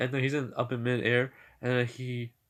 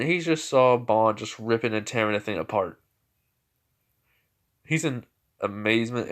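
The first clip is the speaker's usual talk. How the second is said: ordinary delivery, nothing marked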